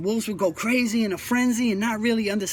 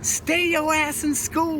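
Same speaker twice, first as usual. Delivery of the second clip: slow, higher, pressing each word; emphatic plea; 5 semitones higher, slower than usual. Real, not acted. Warning street kids one last time, slow and higher. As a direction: hammer the message home, raised and slow. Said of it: "I can't emphasize that enough".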